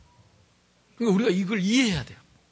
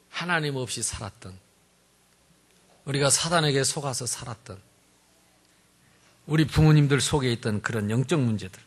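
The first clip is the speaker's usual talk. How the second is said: ordinary talk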